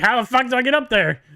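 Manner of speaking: loud, high mock bewilderment; imitating baffled teammate. Player teasing the teammate about forgetting, loud mock-baffled imitation. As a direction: mimic a friend's baffled yell. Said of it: "You're like".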